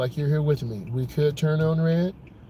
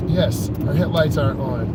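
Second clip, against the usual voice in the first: normal talking voice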